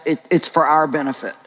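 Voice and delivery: ordinary delivery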